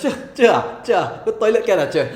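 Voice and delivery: put-on loud, high Manglish kid voice; Malaysian accent. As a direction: play a Malaysian kid pestering teacher. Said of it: "That wasn't how you asked to go toilet".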